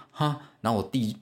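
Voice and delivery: ordinary talk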